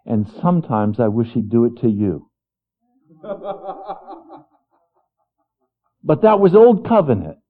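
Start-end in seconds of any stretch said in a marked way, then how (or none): 3.2-4.1 soft laugh. Real, not acted. Laugh after joke.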